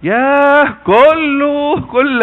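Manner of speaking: excited exclamation. Real, not acted. Preacher exclaims about feast foods.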